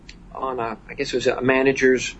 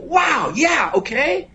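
amazed shout; 11 semitones higher, slower than usual. Retold or imitated. Fred shouting back at the tall stranger, surprised and high. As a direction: blurt it out, half shouting in surprise. Stunned reply to Robbins' idea.

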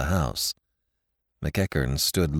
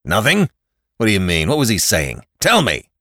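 louder, rapid angry questions; a father interrogates his daughter, louder and angry; angry demand; 6 dB louder than usual. A narrator's reading. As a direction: bark the questions at her. Father demands answers angrily.

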